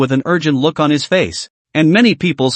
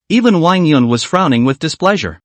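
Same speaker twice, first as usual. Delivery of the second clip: usual speaking voice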